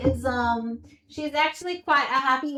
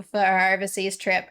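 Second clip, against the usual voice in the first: normal talking voice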